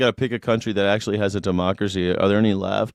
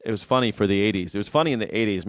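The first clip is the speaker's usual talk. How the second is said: ordinary talk, nothing marked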